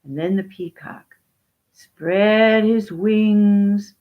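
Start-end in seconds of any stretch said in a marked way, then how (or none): 1.7-4.0 very slow, loud, stretched words; grandly elongated storytelling; drawn-out narration. A narrator's reading. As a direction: stretch each word like opening wings. Narrator stretches the peacock's display.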